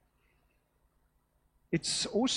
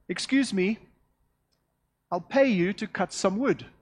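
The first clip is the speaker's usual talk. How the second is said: raised, higher-pitched lady calling out; prim and proper lady; 4 semitones higher, 6 dB louder than usual. Acted. Rich lady calling to a passer-by from her veranda, prim and proper. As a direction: call politely from the veranda, louder and higher.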